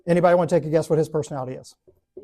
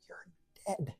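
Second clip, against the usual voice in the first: hushed whisper of doom; whispered threat; 18 dB quieter than usual. Retold or imitated. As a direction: whisper a warning to the kid. Classmate warns the suggester.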